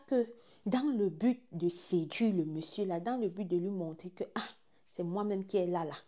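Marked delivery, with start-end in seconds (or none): none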